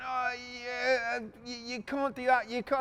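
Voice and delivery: high-pitched, stammering mock protest; put-on squeaky disbelief; flustered protester. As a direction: imitate a rattled owner objecting. Owner protests at threat to machine.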